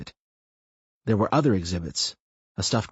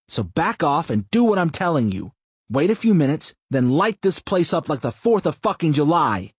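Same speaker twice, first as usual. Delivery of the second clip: brash rebuke; 7 semitones higher, 4 dB louder than usual. A narrator's reading. Chris snaps at Brian.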